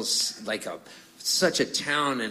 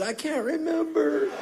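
much higher, dazed little voice; stoned and lost. Retold or imitated. Asked his song after the weed room, dazed and high. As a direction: sound too high to remember anything.

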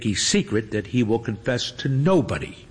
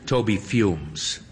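ordinary delivery, nothing marked

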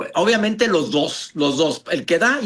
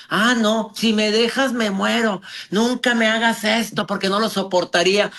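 imitating a desperate, clingy partner, higher pitched; mock pleading, pitched up; clingy plea; 4 semitones higher than usual. Doctor mimicking a woman begging her man not to leave, clingy plea.

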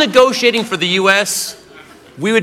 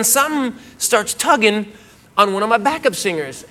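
ordinary delivery

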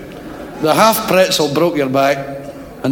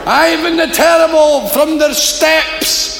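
shouting Glaswegian; 13 semitones higher than usual. Acted. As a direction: bellow across the circus tent. Glaswegian challenger and barker shout.

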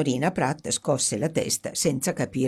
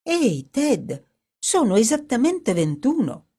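Dorothy's higher, excited voice; excited discovery; 8 semitones higher, 4 dB louder than usual. Acted. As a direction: announce the find in amazement. Dorothy counts the stones, amazed.

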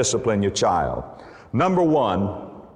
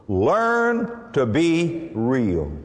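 slow emphasis; much slower than usual. Real, not acted. Preacher stressing key point.

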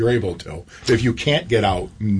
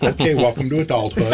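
laughing retort; 2 semitones higher than usual. Retold or imitated. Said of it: "I'm like".

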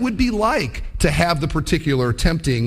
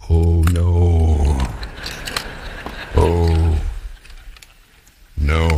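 hooked bass; 14 semitones lower, much slower than usual. Retold or imitated. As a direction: groan it like a doomed fish. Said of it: "and the bass thinks".

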